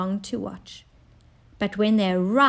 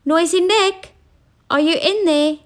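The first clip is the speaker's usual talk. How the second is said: higher, louder call; king calling through the door, louder; 8 semitones higher, 5 dB louder than usual. A narrator's reading. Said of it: "called through the keyhole".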